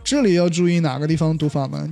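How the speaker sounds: usual speaking voice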